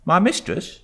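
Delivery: high, surprised maid's voice; sharp young maid. A narrator's reading. Maid turning on the boy, high and surprised. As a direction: snap round at the boy. Maid turns round quickly.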